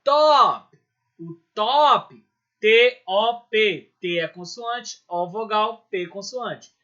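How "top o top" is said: "top" punched loud and high on its own; stressed syllable. Shown as a demonstration. Teacher showing the tonic syllable of stop, strongly stressed. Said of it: "o top é a minha sílaba tônica".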